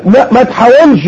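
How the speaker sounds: lightly voiced sulky refusal; mock stubborn. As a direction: play the brother who won't budge. Voicing stubborn brother.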